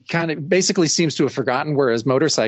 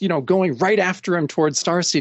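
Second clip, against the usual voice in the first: usual speaking voice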